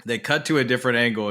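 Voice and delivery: ordinary talk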